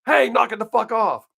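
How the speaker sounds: high, loud yell to stop him; retold angry yell at the man three doors down; yelling at a neighbour. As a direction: shout him down across the yards. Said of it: "I started yelling at him".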